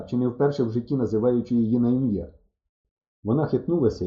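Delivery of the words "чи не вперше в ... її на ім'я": usual speaking voice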